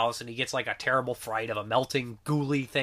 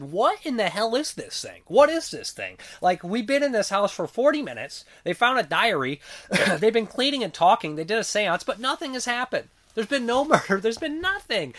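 high-pitched comic exasperation; exasperated disbelief; 8 semitones higher, 4 dB louder than usual. Retold or imitated. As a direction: complain in mock outrage that nothing is going on. Acts out impatience with uneventful movie.

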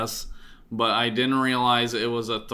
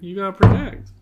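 loud; 9 semitones higher, 8 dB louder than usual. Real, not acted. Urging friend to speak up.

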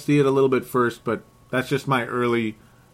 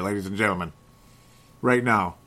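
normal talking voice

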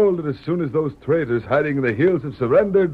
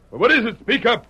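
demanding; 8 semitones higher, 4 dB louder than usual. Acted. Major demands an answer.

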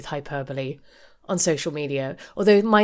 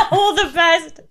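higher, louder laughing repeat; 11 semitones higher, 7 dB louder, slower than usual. Real, not acted. Friend delighted by the curt birthday post, laughing. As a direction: crack up while quoting it. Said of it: "You know what?".

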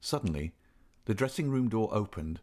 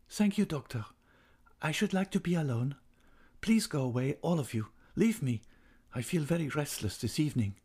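higher-pitched, restless woman's voice; agitated dismissal; 6 semitones higher than usual. A narrator's reading. Restless singer dismissing everyone from the room, lightly agitated. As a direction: send them away with mild agitation. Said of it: "the girl's evident agitation".

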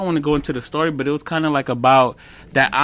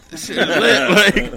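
higher, slow, laughing delight; retold giddy excitement; 7 semitones higher, 4 dB louder, slower than usual. Man reliving his first video's success, laughing excitedly. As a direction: laugh through it, pitch lifted.

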